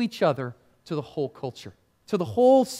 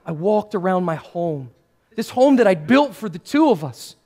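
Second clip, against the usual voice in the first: usual speaking voice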